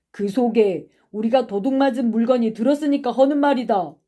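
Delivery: forceful retort; accusing. Acted. Boy accuses classmate of stealing.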